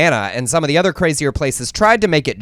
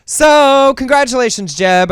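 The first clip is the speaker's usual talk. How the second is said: high, loud, drawn-out mock cheer; sarcastic congratulations; 10 semitones higher, 6 dB louder, slower than usual. Real, not acted. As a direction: announce it big with mock admiration.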